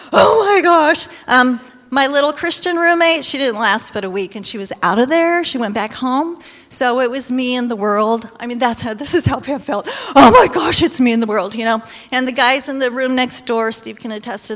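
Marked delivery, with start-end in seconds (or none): 0.1-1.1 shocked gasp. Retold or imitated. Pam shocked by dorm life. 10.1-11.5 a retold loud, horrified oh my gosh; overwhelmed exclamation. Pam's overwhelmed thought.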